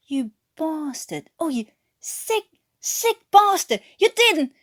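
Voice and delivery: shouting, voice climbing high in rage; slow seething start rising to a shout; furious outburst. Acted. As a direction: spit it out at the traitor. Newkirk rages at Watkins.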